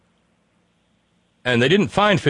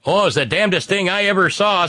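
high-pitched mock amazement; Attorney General; 8 semitones higher than usual. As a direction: play the official marvelling, voice pitched way up. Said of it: "said the Attorney General".

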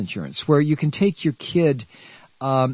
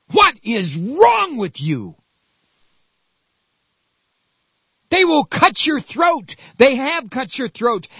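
high, loud outraged yelling at voters; furious shout; 12 semitones higher, 4 dB louder than usual. Real, not acted. Ranting at Reagan Democrat voters.

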